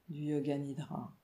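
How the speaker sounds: ordinary delivery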